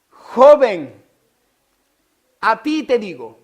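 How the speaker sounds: a ti te digo proclaimed loud and high; commanding call. Acted. Jesus commanding the dead young man, loud. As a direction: call it out over the coffin. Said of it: "Él dice".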